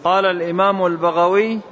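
usual speaking voice

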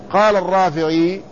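usual speaking voice

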